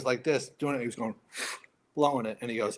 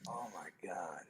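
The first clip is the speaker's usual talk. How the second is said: barely audible whisper to oneself; hushed, voiceless aside; whispered dread; 7 semitones lower, 19 dB quieter than usual. Retold or imitated. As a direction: whisper it in retold despair. Dread, muttered to himself.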